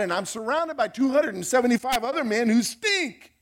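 exasperated, whiny complaint to God; complaining Paul. Pretend. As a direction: rant like a fed-up prisoner. Preacher voices complaining Paul.

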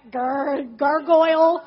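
loud, drawn-out gargoyle growl; mock gargoyle. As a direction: turn suddenly into a comic monster. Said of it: "all of a sudden I'm like".

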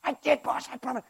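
high, soft protest; small cowering henchman; pleading imp. Acted. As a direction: squeak out an excuse to the boss.

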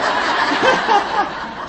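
high breathy burst of laughter; big laugh. Real, not acted. Laughing at her own joke, a high breathy laugh. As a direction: laugh out at your own story.

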